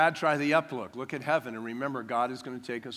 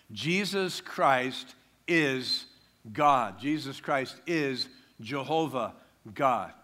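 usual speaking voice

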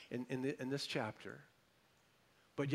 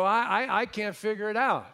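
high, loud, baffled protest; baffled listener; 8 semitones higher, 11 dB louder than usual. Retold or imitated. Confused congregant complaining to the pastor, high and loud. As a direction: squeal it like a confused churchgoer. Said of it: "You go".

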